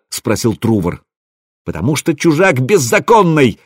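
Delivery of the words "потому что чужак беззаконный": high, angry shout, lightly acted; furious outcry. A narrator's reading. Rasmus answers why his brother was shot, furious outcry. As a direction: hurl the accusation in a raised pitch. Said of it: "яростно выкрикнул Расмус".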